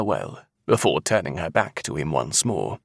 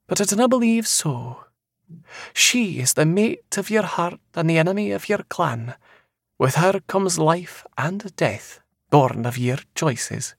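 eerie seer; 11 semitones higher than usual. Acted. Seer foretelling a man's future, high and eerily certain.

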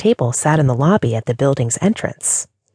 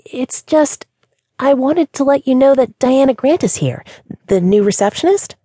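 high, stammered, a touch of fear; bright voice with a trace of fear; timid apology; 11 semitones higher than usual. Acted. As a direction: apologize nervously to the boss. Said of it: "a trace of fear in it".

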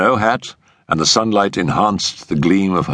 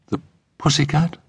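high, questioning PUSSYCAT with a rise; "pussycat" lifted high in puzzlement; puzzled echo; 10 semitones higher than usual. Acted. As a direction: repeat "pussycat" as a baffled question. Puzzled echo after strange look.

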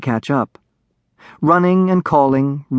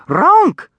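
high, loud creature honk; dragonling squawk; 5 dB louder than usual. A narrator's reading. Dragonling struggling to get away, loud squawk. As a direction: squawk it sharply, pitched way up. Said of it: "it squawked".

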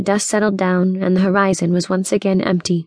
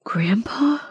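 stunned, whispered question; breathed; 3 semitones higher, 4 dB quieter than usual. Acted. West sees his lost grandpa, stunned breath. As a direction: whisper it in disbelief. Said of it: "he breathed".

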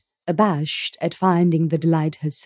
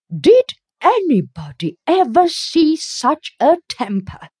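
horrified; 9 semitones higher, 4 dB louder than usual. A narrator's reading. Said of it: "exclaimed the horrified Missus Rachel".

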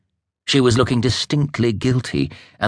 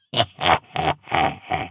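soft, harsh cackle like knives on steel; scraping witch laugh; 3 semitones lower, 4 dB quieter than usual. Acted. Old witch mocking the shallow witches, grating laugh. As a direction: let out a cold, grating laugh. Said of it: "gave a laugh like knives scraping steel".

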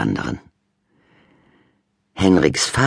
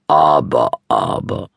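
smiling dad; 4 dB louder than usual. A narrator's reading. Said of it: "sagte Papa und griff lächelnd".

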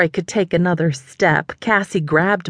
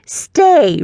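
high, louder command; firm command to dog; 9 semitones higher, 5 dB louder than usual. A narrator's reading. Nana orders her stuffed dog to stay, high and firm. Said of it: "she said".

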